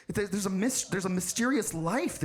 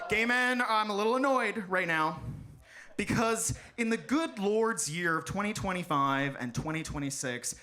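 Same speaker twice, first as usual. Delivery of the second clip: ordinary delivery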